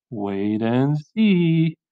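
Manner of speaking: very slow, drawn-out promise; teasing assurance. Acted. Olivia answering her doubting father, slow and knowing. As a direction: stretch out the last word knowingly.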